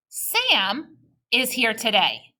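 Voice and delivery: Sam is here today in smooth scoops, slowly; scooped phrasing. Shown as a demonstration. Teacher reading with kids, slow scooped phrasing. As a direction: lead the class slowly and smoothly. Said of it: "Try it with me".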